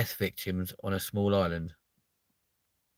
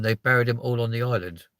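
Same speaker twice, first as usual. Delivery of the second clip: usual speaking voice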